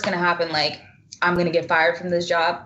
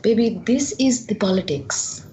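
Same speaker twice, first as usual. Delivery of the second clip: put-on father's thick Bengali accent; impersonating a Bengali father; 3 semitones higher than usual. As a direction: imitate a dad with a strong Bengali accent. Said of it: "such a good Bengali accent".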